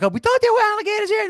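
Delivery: imitating a kid, high-pitched; excited kid. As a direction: squeal it like an alarmed kid. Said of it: "then the kid's like".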